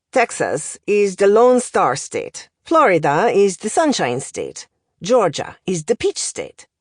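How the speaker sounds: lightly voiced accented professor, lively lilt; language professor. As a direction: deliver it like a pedantic foreign tutor. Professor recites state nicknames.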